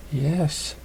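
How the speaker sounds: soft, hushed yes; whispered yes. Acted. Tearful mare answers his confession, softly. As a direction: breathe the yes out. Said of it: "whispered Rainbow Drops".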